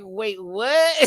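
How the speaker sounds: high mock double take; stunned disbelief. Retold stunned reaction to remark.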